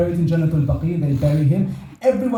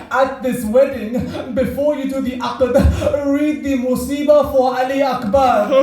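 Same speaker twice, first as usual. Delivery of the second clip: impassioned majlis recitation; 6 semitones higher than usual. Acted.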